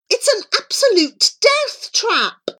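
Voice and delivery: very high, alarmed squeal; frantic, appalled objection; panicked protest. A narrator's reading. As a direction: exclaim in high-pitched horror.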